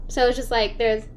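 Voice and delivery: ordinary talk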